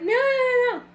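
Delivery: high, insistent imitation of someone saying no; mock refusal. Coach imitating followers who reject praise, high mock protest. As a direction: bat the compliment away, playfully mocked.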